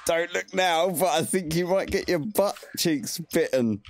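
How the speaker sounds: high-pitched voice in someone's head; telepathic warning. Acted. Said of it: "use silent speech to talk to him in his mind".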